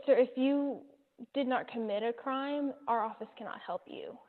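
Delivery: ordinary delivery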